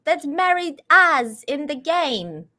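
put-on British accent. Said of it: "working on my British accent".

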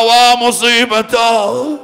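long, loud wail of calamity; anguished drawn-out lament; crying out in grief. A narrator's reading. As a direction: cry it out and stretch it slowly. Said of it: "صاح".

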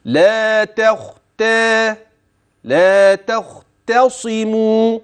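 slow chant of لا تختصموا, thin ت, thick خ and ص; light tāʾ, heavy khāʾ. Shown as a demonstration. Teacher demonstrating light and heavy letters in لا تختصموا. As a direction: lighten each ت and weight the خ in لا تختصموا. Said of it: "التاء ترقق".

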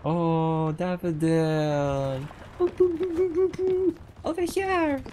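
high, long drawn-out calling; stretched, playful summons up high; singsong call. Pretend. Calls the cat in game.

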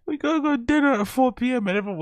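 high, silly mock voice; mocking impression. Mocking Brendan leaving for dinner at four, high and silly. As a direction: ham up his lame excuse. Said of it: "mocking Brendan's dinner excuse".

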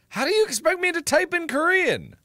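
high, loud exasperated yelling; frustrated yell. Real, not acted. Streamer yelling at the language app, high and loud.

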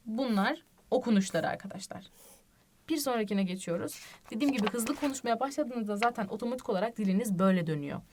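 ordinary talk, nothing marked

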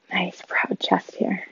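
slow, soft whisper; hushed cue as the class stretches. Real, not acted.

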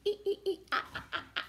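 monkey call. Acted. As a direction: chatter like a playful monkey. Monkey sounds in story.

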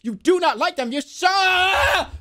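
rising high into a yelled last word; reading building to shout. Pretend. Reading a picture book aloud, exploding into a shout. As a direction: blow up on the final word.

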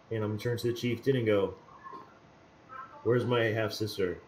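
ordinary talk

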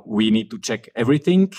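usual speaking voice

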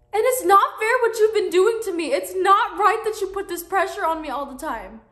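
loud hurt accusation; angry shouting. Acted. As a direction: throw it back at her, loud. Teen yells at her mother.

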